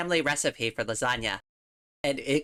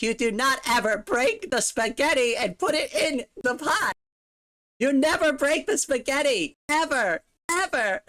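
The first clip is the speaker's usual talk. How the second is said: great-grandmother scolding; 8 semitones higher than usual. Retold or imitated. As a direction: scold the kid for breaking spaghetti. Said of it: "I thought she was gonna kill me".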